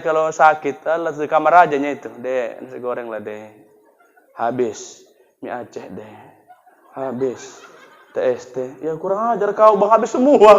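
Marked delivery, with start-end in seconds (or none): 2.1-8.8 low, soft, feeble pleading; weak sick husband. Acted. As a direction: beg the wife faintly from the sickbed. Sick husband asks wife for food. (suami sakit memelas minta makan)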